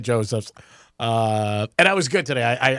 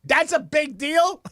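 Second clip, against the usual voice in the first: much higher, slow mock-incredulous mom; mother's disbelief; 12 semitones higher, slower than usual. Mother reacting to the news, high slow disbelief. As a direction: stretch it out like a stunned mother. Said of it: "She's like".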